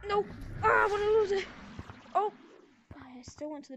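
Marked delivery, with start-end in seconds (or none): 0.1-2.3 high panicked cry; being chased. Real, not acted. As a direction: yell it in a panic.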